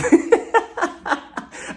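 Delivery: airy, high burst of laughter; high breathless laugh. Real, not acted. Vlogger laughing at the Margaret quote, breathy high laugh. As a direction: crack up at the quote.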